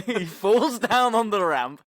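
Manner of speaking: loud, high, slowed by laughter; real cracking up. Real, not acted. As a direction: burst out laughing at the punchline. Cracks up at the stunt failing.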